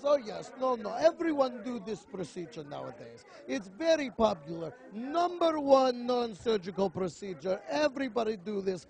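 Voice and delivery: lightly put-on foreign doctor accent; broken-English clinician, lightly voiced; hint of an accent pitching the procedure. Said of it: "he goes".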